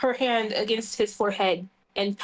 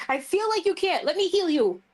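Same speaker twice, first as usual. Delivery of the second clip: insistent high plea; 8 semitones higher, faster than usual. Acted. Healer insists on healing him.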